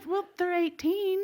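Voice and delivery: high, drawn-out defensive voice; slow, squeaky mock innocence; sheepish excuse. Woman tells officer they're eighteen, high sheepish excuse.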